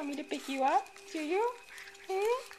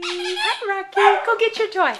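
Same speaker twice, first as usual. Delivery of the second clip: excited calling; 2 semitones higher, 14 dB louder than usual. Real, not acted. Calling the dog to play.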